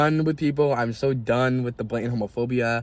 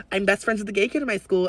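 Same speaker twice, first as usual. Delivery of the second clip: higher, sing-song mock of a fake ally; mocking girl; 7 semitones higher than usual. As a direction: parody the fake-friendly girl.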